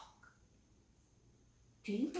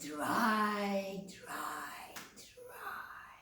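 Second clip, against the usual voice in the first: fading out; 8 dB quieter, much slower than usual. Acted. Repetition fading to whisper.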